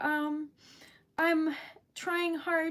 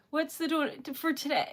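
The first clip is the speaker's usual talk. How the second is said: ordinary talk, nothing marked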